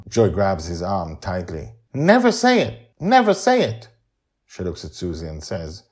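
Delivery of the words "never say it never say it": put-on high, loud, urgent child voice; urgent command. A narrator's reading. Girl forbids her robot friend to curse, urgent and loud. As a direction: plead loudly like an alarmed kid.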